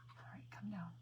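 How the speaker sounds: hushed whisper to herself; soft focused whisper. Real, not acted. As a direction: whisper it while you concentrate. Whispering to herself while drawing.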